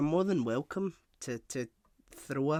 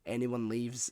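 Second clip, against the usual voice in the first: normal talking voice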